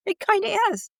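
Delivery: high, cheeky comeback with a laugh; sassy retort. Retold or imitated. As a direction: toss it back high and teasing. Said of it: "I'm like".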